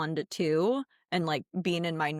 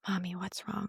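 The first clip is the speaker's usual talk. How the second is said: put-on soft little-boy question; 10 dB quieter than usual. Son's worried question in car.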